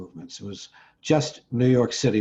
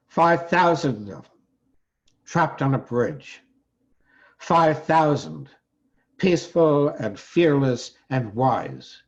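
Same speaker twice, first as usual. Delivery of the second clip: louder, higher, measured public recital; poem recitation; 5 semitones higher, 6 dB louder, slower than usual. Acted. Poet telling of five thousand protesters trapped, solemn declamation. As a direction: declaim each phrase with deliberate pauses.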